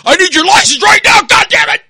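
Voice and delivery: very loud, high-pitched full-out scream; screaming cop. Retold or imitated. Cop screaming at driver for his license, enraged scream. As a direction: explode into an enraged screamed order. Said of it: "he was screaming at me".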